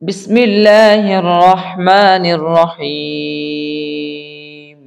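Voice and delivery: slow, drawn-out devout recitation. Real, not acted. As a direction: intone the opening blessing slowly and devoutly. Opening the Quran reading lesson.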